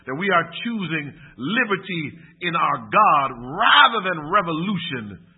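loud, stretched-out sermon emphasis; emphatic preaching. Real, not acted. Preacher urging church to choose liberty in God, loud and emphatic. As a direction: drive the appeal home forcefully. Preacher drives the appeal home.

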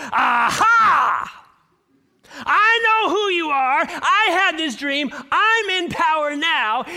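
high, loud triumphant gloat, slow; gloating Joseph. Pretend. Pretend Joseph gloating over bowed brothers, loud and high. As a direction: crow over the brothers like a tyrant. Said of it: "so tempting at that moment to say".

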